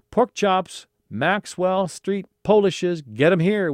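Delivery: high, loud street-vendor call; vendor's yell. Acted. Street vendor hawking food to a crowd, yelled. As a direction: call out wares, high and drawn out. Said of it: "one vendor yelled".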